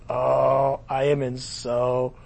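low, soft, slow despair; lightly hammed-up doom; mock dread. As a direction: moan it in mock dismay. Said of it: "He's like".

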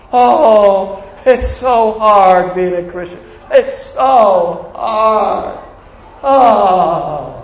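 drawn-out mock moan, very slow; mock whining. Mocking a complaining believer.

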